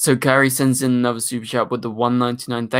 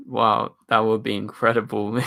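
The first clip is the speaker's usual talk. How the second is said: ordinary delivery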